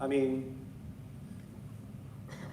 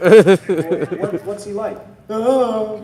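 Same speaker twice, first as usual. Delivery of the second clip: laughing; 8 semitones higher, 16 dB louder, much slower than usual. Retold or imitated.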